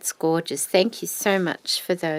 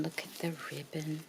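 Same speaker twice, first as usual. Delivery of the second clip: hushed; 3 semitones lower, 13 dB quieter, faster than usual. Real, not acted. Quiet admiring.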